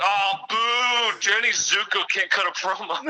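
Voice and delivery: high, hammed-up boo from the crowd; mock heckle, pitched well up; heckling wrestling fan. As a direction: jeer the stage actors like a rowdy fan. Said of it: "would get all those fans".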